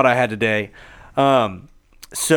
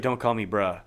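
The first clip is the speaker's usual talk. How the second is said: deadpan imitation, lower and softer; straight-faced retort; 5 semitones lower, 6 dB quieter than usual. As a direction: deliver her rebuke completely straight-faced. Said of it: "Like, straight face".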